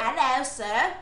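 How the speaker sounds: playful mock English accent. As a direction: greet in a put-on English accent. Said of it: "talking to you in an English accent".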